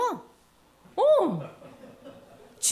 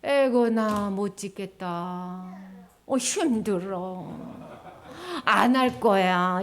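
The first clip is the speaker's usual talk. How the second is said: slow, low, whiny sigh of defeat; discouraged workers quitting the temple; giving up; 6 semitones lower, much slower than usual. Acted. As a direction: drag it out like you've quit.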